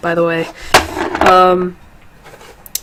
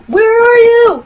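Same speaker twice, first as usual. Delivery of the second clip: high sing-song call; 4 dB louder, slower than usual. Real, not acted. Searching for the game cartridge.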